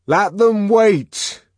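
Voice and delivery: high, drawn-out loud refusal; defiant shout from another room; shouting back. A narrator's reading. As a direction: yell it back through a closed door. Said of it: "came back the muffled voice through the door".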